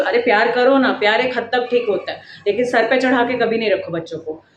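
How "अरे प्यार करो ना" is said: ordinary talk, nothing marked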